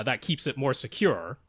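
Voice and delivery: ordinary talk, nothing marked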